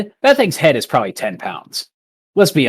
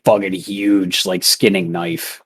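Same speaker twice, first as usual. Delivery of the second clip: ordinary talk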